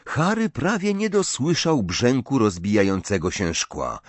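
plain reading voice

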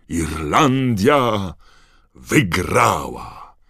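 loud, very slow drawn-out proclamation; announcer's shout. A narrator's reading. Commentator proclaims the winner to the crowd, shouting. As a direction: shout it stretched out like a stadium announcer. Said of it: "krzyknął Bergman".

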